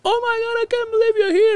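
very high imitation of a bawling wife; mock tearful. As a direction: squeal it like overwhelmed, crying joy. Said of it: "She started crying".

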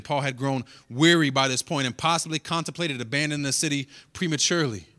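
usual speaking voice

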